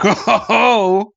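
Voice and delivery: slow, high "oh ho ho"; drawn-out, high imitation of ogling; mock leering chuckle. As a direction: imitate sitcom men perking up. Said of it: "And they'll be like".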